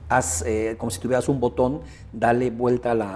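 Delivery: ordinary delivery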